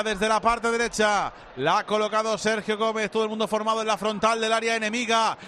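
sustained, driving football commentary; live play-by-play. Real, not acted. Radio commentator describes a Spain free kick, live play-by-play. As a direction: call the free kick live, evenly projected.

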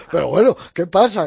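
high, booming retold complaint; acted loud protest. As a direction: act out an exasperated film crew, loudly. Crew reacting in retold film shoot.

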